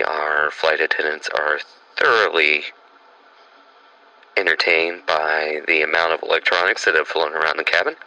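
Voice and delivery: lightly voiced airline pilot on the intercom; calm, low cabin announcement from the captain. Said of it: "can you land us?".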